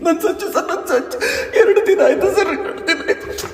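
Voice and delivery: desperate sobbing plea, breaking into cries. Acted. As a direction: beg through tears for her lost son. Crying mother at station. (ಬಿಕ್ಕಿ ಬಿಕ್ಕಿ ಅಳುತ್ತಾ ಬೇಡಿಕೆ)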